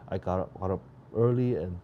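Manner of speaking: talking through a yawn; tired, mid-yawn words. Real, not acted. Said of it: "sorry about yawning".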